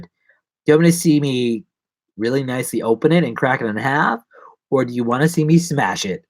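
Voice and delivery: ordinary delivery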